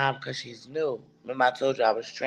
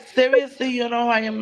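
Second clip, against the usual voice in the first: higher, louder imitated disbelief; mock disbelief; 9 semitones higher, 3 dB louder than usual. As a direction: play her shocked that you don't know her. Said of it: "She like".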